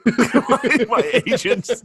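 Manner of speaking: high, giggling correction; laughing at the slip. Real, not acted. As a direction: laugh through the fix.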